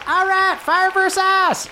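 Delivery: very high, yelling crowd member; excited heckler. Acted. Somebody yells before throwing a flaming stick, excited. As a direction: squeal it out from the crowd. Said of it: "and somebody goes".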